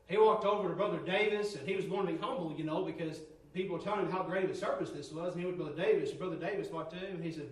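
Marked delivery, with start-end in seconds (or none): none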